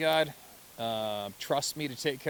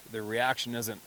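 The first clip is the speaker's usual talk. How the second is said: normal talking voice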